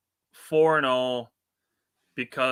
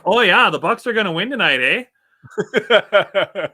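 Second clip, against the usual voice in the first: high, loud hammed-up Canadian accent with eh; mock Canadian accent; 8 semitones higher, 10 dB louder, slower than usual. Mocking how outsiders hear Wisconsin, hammed-up Canadian accent. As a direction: play a jokey Canadian fan. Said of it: "So they're like, "Oh my God, are you guys Canadian?".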